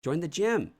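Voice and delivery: incredulous. Real, not acted. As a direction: repeat it in disbelief. Incredulous repeat of suggestion.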